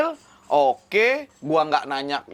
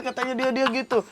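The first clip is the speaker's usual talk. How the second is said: normal talking voice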